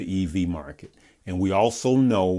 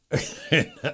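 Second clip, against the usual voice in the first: short laugh; 6 semitones higher than usual. Real, not acted. Laughing at the story.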